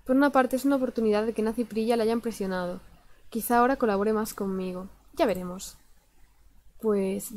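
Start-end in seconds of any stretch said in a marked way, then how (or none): none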